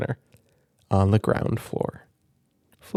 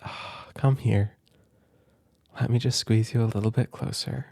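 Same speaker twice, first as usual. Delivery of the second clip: tender cuddle; 5 dB quieter than usual. Real, not acted. Parent cuddling the child after reading, tender and soft.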